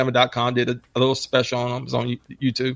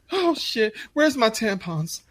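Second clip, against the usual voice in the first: very high falsetto fuss; mocking prissy kid; 8 semitones higher than usual. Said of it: "I'm like".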